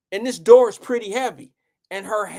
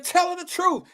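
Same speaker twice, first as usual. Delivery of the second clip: much higher desperate plea; high pleading; 10 semitones higher than usual. Retold or imitated. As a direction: squeak it out, begging. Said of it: "and I said".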